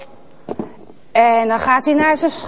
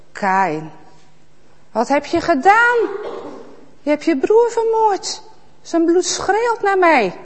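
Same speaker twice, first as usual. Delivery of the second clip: higher, grave accusing voice; accusing God; 6 semitones higher than usual. Acted. God confronting Kaïn with the murder. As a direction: confront him with sorrow.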